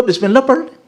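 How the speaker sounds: a hint of a pushy friend coaxing; mock coaxing friend. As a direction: mimic him urging lightly. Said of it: "کہنے لگا".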